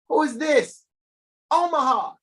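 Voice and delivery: high, loud astonished call; mock disbelief. Host calling out a mock-stunned city name to viewers. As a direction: shout it up in theatrical surprise. Reacting to next city document.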